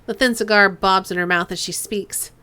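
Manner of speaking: normal talking voice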